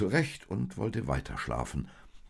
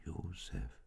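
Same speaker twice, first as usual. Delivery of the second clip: hushed whisper to a sleeper; barely voiced, very soft whisper; 11 dB quieter than usual. A narrator's reading. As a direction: whisper the name gently. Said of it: "und flüsterte".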